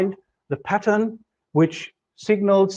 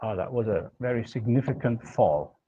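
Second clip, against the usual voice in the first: normal talking voice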